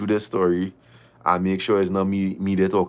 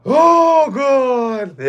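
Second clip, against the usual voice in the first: high, loud gasp of disbelief; shocked exclamation; 13 semitones higher, 7 dB louder than usual. Real, not acted. Reacting to the empty apartment, loud shocked cry. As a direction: cry out as if you just saw something awful.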